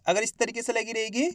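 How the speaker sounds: normal talking voice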